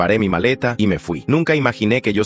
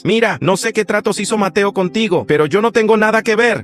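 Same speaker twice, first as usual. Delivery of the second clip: alarmed protest; 11 semitones higher, 4 dB louder than usual. Acted. Narrator protests threat on phone.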